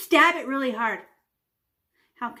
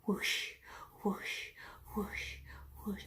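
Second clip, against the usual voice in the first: fading whooshes; 3 semitones lower, 14 dB quieter, slower than usual. Shown as a demonstration. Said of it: "Better do it a couple times".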